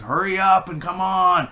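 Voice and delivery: high, loud, exasperated call; impatient mock yell. Bored viewer urging the film along, impatient shout.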